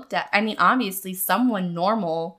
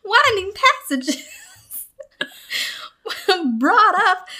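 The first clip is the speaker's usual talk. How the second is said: much higher, louder, halting Christopher Walken read, breaking into laughs; 11 semitones higher, 5 dB louder than usual. Pretend. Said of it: "sounding a little Christopher Walken".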